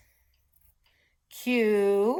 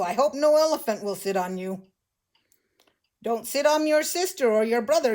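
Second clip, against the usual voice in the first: usual speaking voice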